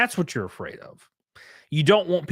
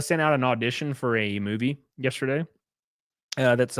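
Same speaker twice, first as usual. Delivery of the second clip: normal talking voice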